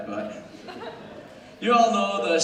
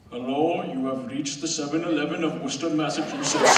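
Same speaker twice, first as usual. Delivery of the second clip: put-on Indian accent; 5 dB louder than usual. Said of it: "I can replicate that accent pretty faithfully".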